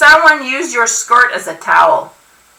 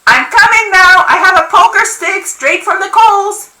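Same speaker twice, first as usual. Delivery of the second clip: high, loud grandmother's threat; yelled warning; 9 semitones higher, 5 dB louder than usual. A narrator's reading. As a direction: shout a warning at whoever is outside. Deaf grandmother yelling a warning.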